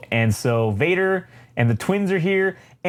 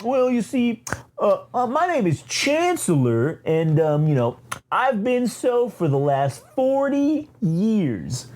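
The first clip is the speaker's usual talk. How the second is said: high, hemming and hawing put-on voice; self-important, pitched-up official, stretching the last words; pompous chancellor; 7 semitones higher, slower than usual. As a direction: boast of long service in a high voice. Chancellor pompously answers the queen.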